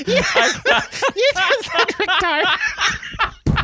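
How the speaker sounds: high, drawn-out laughing disbelief. Real, not acted. Cohost cracks up at the guest's name slip, laughing. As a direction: laugh the words out slowly in disbelief.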